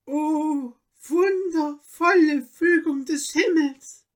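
high, slow, tearful exclamation; deeply moved. A narrator's reading. Countess to her astonished husband, deeply moved. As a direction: marvel aloud, overcome with emotion. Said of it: "sprach sie gerührt".

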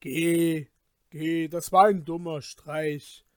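loud, slow, looming villain; scornful warning from a giant; booming giant voice. A narrator's reading. Giant scolds and warns Peter. (dröhnender Riese, verächtlich)

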